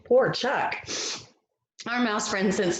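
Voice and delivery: ordinary talk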